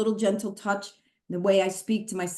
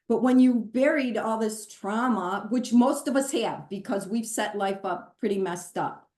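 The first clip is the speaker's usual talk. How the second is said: ordinary talk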